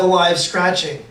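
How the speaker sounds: ordinary delivery, nothing marked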